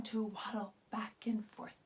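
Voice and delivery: unvoiced whisper, softly; soft whispered aside to the kids. Shown as a demonstration. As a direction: breathe the words out like a secret. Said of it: "whispered demonstration line".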